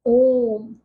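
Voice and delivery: ओम with the ओ held very long; prolonged vowel. Shown as a demonstration. Teacher demonstrates the pluta vowel, stretching ओम. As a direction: hold the ओ in ओम far past a long vowel. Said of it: "दीर्घ स्वर से भी अधिक समय लगता है".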